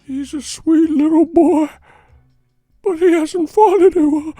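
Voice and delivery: very high, slow, eerie wail; hammed-up ghostly interviewer, wailing each phrase; ghoul impression. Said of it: "sound like a ghoul and shit".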